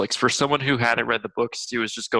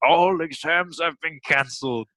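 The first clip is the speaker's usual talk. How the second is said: lightly voiced headmaster, pitched up; a hint of a school head's announcement; imitated Dumbledore; 5 semitones higher than usual. Host retelling the film's ending, mock headmaster announcement. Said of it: "Dumbledore, ... he's like".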